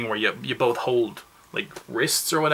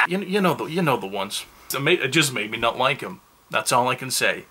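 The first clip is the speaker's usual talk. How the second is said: ordinary delivery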